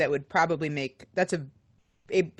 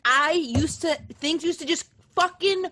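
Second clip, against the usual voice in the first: frustrated whine; 7 semitones higher than usual. Real, not acted. Frustrated about writing block.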